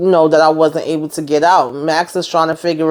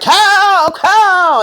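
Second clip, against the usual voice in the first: much higher, louder frantic cry of the name; frantic call; 3 dB louder than usual. Retold or imitated. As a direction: cry out his name in panic. Max calling after Cal.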